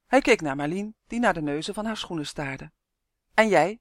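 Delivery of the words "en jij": sharp, loud challenge; fiercely demanding, with force. A narrator's reading. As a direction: turn on her with a pointed question. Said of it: "vroeg hij fel".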